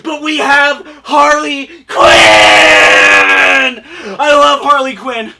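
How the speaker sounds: overwrought fangirl weeping with joy; hammed-up crying joy. Collector gushing over Harley Quinn figure, hammed-up crying shout.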